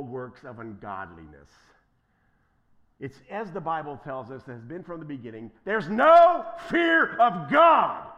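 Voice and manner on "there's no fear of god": slow, loud, high shout; preached shout. Real, not acted. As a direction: thunder it out to the congregation. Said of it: "preaching at full force".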